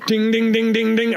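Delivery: rapid high bell dings; imitated ringing bell. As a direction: ring out like a bar bell. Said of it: "A big bell at the bar begins ringing loudly".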